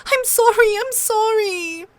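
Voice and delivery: high, broken sobbing repeats, slowing at the end; a child crying her apology. A narrator's reading. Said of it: "she sobbed".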